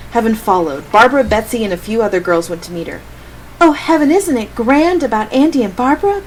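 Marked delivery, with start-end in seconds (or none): 3.6-6.2 high, bubbly gushing. Acted. Betsy gushing to Heaven about the engagement, high and excited. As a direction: gush the gossip with delight. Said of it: "Betsy gushed".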